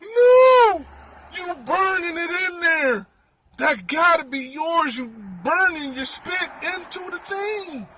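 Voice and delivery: gasp, then high wailing objection; hammed-up panicked plea; horrified protest. Acts out his horrified protest.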